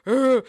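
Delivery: high, loud mock "grr"; put-on bear-like grr; playful growl. Shown as a demonstration. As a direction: growl it out, half laughing at yourself. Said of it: "I try to do the grr".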